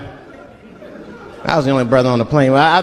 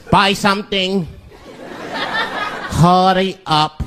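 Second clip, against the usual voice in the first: mock growl; 3 semitones higher, slower than usual. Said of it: "growls at me".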